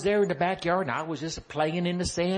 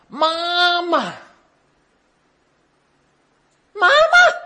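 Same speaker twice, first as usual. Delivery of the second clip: put-on much higher, very loud child's call; hollering for mama; 11 semitones higher, 11 dB louder, much slower than usual. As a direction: yell for mama through the house.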